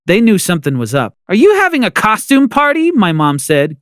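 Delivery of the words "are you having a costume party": annoyed. Acted. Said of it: "clearly annoyed".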